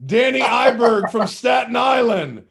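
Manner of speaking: high, loud and slow, calling out the winner's name; thrilled call-out of a listener's name; excited shout. Real, not acted. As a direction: announce the name like a game show host. Announcing a correct listener guess.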